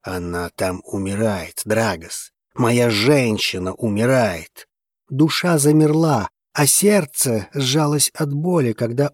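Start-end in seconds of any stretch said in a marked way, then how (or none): 0.0-4.7 low, subdued despair; despairing husband. A narrator's reading. Zein to Dragos about his wife, low despair. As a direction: say it low, weighed down by dread.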